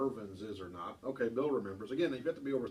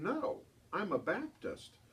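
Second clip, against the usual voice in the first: put-on slow, higher childlike reply; child's innocent answer; 6 semitones higher, slower than usual. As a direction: say it slowly with a child's earnest innocence. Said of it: "And she said".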